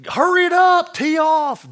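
put-on very high, very loud cranky-old-man shout; impatient yell. Old man yells at golfers to tee off, shrill shout. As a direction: bark like an angry club member.

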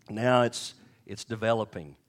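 normal talking voice

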